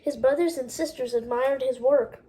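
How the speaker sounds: ordinary delivery, nothing marked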